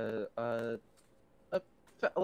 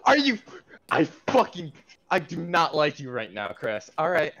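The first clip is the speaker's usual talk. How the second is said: mock angry; 5 semitones higher, 10 dB louder than usual. Real, not acted. Mock anger at the GM.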